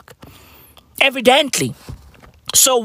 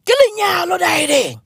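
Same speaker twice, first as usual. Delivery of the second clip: high shout; 7 semitones higher than usual. Pretend. Shouted foreign word.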